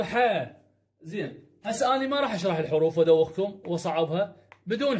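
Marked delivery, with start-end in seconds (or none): 0.0-0.2 ح said as an open "haa"; ha with fatha. Shown as a demonstration. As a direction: sound out ح with a drawn-out a vowel. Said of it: "حرف الحاء اذا بالفتحه".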